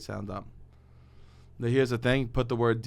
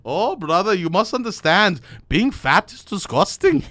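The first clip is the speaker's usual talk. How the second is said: put-on higher, louder Irish accent, slowing at the end; mock Irish accent; 8 semitones higher, 7 dB louder than usual. Irish accent, lecturing his friend at the bar. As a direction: preach it in a hammed-up Irish lilt. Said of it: "how do you do an Irish accent?".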